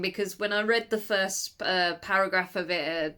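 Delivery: ordinary talk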